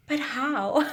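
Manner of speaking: high, shocked "But how?"; stunned mother. Retold or imitated. Mother stunned by her daughter's pregnancy news, high and shocked. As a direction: mimic a mother caught off guard. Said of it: "she's like".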